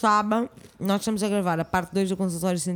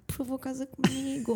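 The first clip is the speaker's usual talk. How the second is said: breathless pleading sigh, softer; swooning plea; 5 semitones higher, 6 dB quieter than usual. Retold or imitated. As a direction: plead dreamily, half-swooning. Quoting her swoon at Brazilians.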